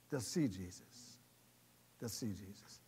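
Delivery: low, soft, settling close; earnest hush. Real, not acted. Preacher closing before the congregation prays, hushed. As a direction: bring it down gently toward prayer.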